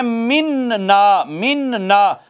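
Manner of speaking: "मिन ना" slow, with a long held n before ना; नून साकिन दिखाकर. Shown as a demonstration. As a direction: dwell on the n of "मिन ना". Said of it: "नून साकिन". ("मिन ना" में नून साकिन खींचकर)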